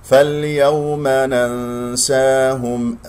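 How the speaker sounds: slow tajweed chant; Quran recitation. Shown as a demonstration. Teacher reciting the verse, slow chant. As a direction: recite slowly in measured tajweed.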